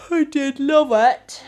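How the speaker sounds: high, loud and drawn-out; overplayed gushing; emphatic insistence. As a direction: belt it out, slow and theatrical. Insisting she loved it.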